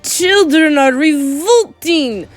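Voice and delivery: loud, high, drawn-out scream; high screamed proclamation. A narrator's reading. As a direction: scream it out slowly as a tyrant witch. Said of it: "screamed the Grand High Witch".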